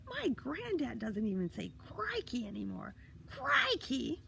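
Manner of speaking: put-on high, jeering kid voice; scoff, then hoot the last word high and loud; squeaky teasing from up a tree; wild mocking hilarity. A narrator's reading. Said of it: "the voice in the tree laughed wildly".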